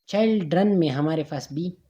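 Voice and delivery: ordinary talk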